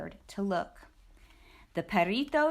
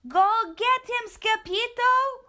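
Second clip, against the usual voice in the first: much higher, louder, slow cheer; cheering cry; 13 semitones higher, 6 dB louder, slower than usual. Acted. Puchitos cheering Scapito on, loud and high. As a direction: urge him on. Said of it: "cried the Puchitos".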